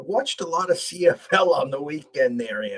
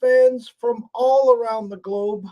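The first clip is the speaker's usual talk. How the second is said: drawn-out, loud, higher showman greeting; big announcer opening; 10 semitones higher, 9 dB louder, slower than usual. Acted. Host greeting sports fans worldwide, booming announcer voice. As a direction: stretch the hello like a TV host.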